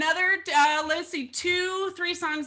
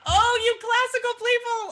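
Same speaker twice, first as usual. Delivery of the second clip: playful laughing tease; 11 semitones higher, 6 dB louder than usual. Real, not acted. Teasing a classical-trained viewer.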